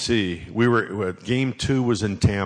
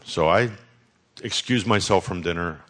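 ordinary talk, nothing marked

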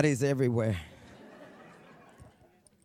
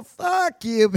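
put-on kid cursing back; 8 semitones higher, 5 dB louder than usual. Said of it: "I go".

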